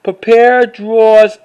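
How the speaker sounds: ordinary talk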